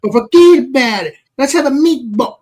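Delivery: put-on joking Italian American accent; mock Italian. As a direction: ham up the Italian mobster bit.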